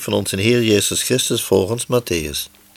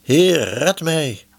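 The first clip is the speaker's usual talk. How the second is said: higher-pitched plea, only lightly raised; understated cry for help, pitched up; mild narrated cry; 6 semitones higher, much slower than usual. A narrator's reading. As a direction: read a restrained cry for rescue. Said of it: "en schreeuwde".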